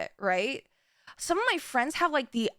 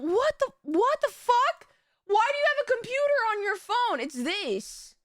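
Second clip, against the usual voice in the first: shocked disbelief; 8 semitones higher than usual. Retold or imitated. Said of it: "And I was like".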